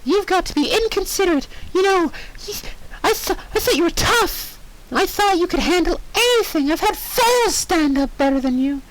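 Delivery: high pony telling off; high, pushy pony nagging; scolding Pinkie. Acted. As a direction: berate her like a cross cartoon pony.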